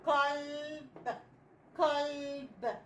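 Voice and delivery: قلب split as a long qal, then a short b; qal, pause, clipped b; sounded-out word. Shown as a demonstration. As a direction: sound out قلب slowly in parts. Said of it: "بقت كلمة إيه؟".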